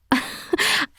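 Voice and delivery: light embarrassed chuckle; soft breathy laugh. Acted. Princess laughing at misnaming Ray, soft breathy laugh. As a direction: laugh off the slip softly. Laugh at her own slip.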